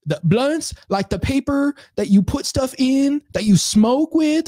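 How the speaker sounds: mock customer explaining blunts to the cashier. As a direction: stretch it out high and slow, patiently explaining. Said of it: "she's just like".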